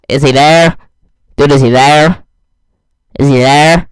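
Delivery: panicked repeated scream, very loud. Retold or imitated. Mark screaming to his friend in the woods, loud panicked scream. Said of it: "Like, screaming".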